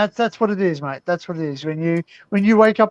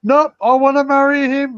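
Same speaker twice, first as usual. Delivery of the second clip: put-on drunk groom; 7 semitones higher, 6 dB louder than usual. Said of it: "he goes".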